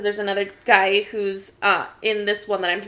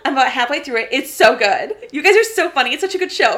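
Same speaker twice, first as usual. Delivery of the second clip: niece gushing; 3 semitones higher, 7 dB louder than usual. Retold or imitated. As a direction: rave like the thrilled niece. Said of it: "She's like".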